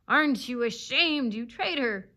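loud, bitter reproach; embittered growl. A narrator's reading. Wounded fox shaming the dog, bitterly growling. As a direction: accuse with restrained fury. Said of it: "he growled".

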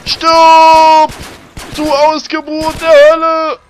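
loud, high furious yell; battle cry. Acted. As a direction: scream at the monster in mock fury. Gaming, yelling at enemy.

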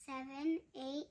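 usual speaking voice

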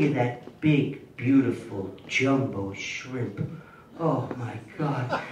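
slow, low, hushed savoring; a hushed, slow food reverie. Acted. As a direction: linger over every word like a lover.

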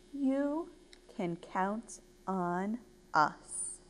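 soft, slow promise; reassuring. Acted. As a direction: reassure gently, word by word. Sheep reassures Ava.

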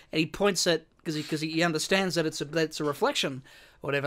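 usual speaking voice

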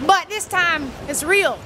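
usual speaking voice

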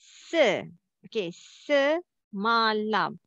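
semalam slowly broken into se, ma, lam; syllable by syllable. Shown as a demonstration. Teacher showing students the syllables, semalam slowly split up. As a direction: sound out semalam in three separate, slow syllables. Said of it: "what you have to do".

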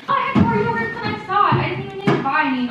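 surprised. Real, not acted. Finds extra yogurt in fridge.

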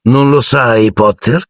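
usual speaking voice

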